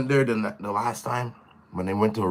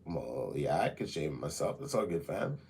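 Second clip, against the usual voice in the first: low, much softer mumble; mumbled reply; 10 semitones lower, 14 dB quieter than usual. Retold or imitated. Answering the nurse in a low, soft mumble. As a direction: mumble it back, deflated. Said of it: "I was like".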